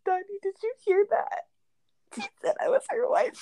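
put-on high giddy little-kid voice; excited childish voice. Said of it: "She'd be like".

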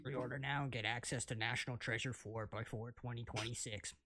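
infomercial. Pretend. Mock ad pitch.

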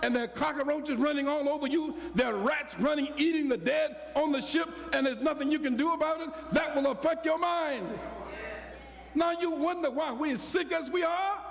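full-force preaching build. Acted. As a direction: climb and press each horror harder.

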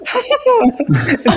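high burst of laughter; high-pitched giggling fit; laughing. Real, not acted. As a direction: crack up helplessly. Cracks up at co-host.

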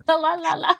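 sing-song put-on French accent. Shown as a demonstration. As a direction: toss off a French-accented refrain. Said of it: "but with a French accent".

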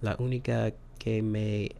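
quiet, slow Spanish line; soft quoted Spanish. Retold or imitated. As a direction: murmur the line tenderly. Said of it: "he was like".

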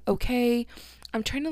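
ordinary talk, nothing marked

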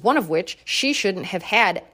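normal talking voice

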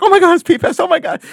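squealing high and loud, losing it; starstruck fan. Retold or imitated. Guitarist meeting [name], squealing with excitement. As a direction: gush like a fan meeting his idol. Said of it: "He's like".

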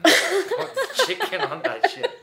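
laughing while saying it. Real, not acted. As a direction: crack up mid-sentence. Laughing at own remark.

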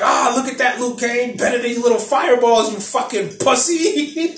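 imitated jeering boast, pitched high; mock gloating taunt. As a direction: crow in triumph, hamming it up. Acting Kano gloating at Liu Kang.

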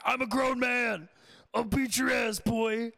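hammed-up angry grown man threatening a troll; mock yell. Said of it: "people still make fun of you for yelling".

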